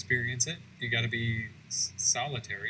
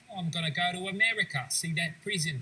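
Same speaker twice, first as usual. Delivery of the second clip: put-on higher, quick British accent slipping into Australian; bad Dickens impression, Australian-sounding; 6 semitones higher than usual. Friend voicing Dickens heading to America, botched accent sounding Australian. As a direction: attempt British, land on Australian. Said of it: "It always comes out Australian".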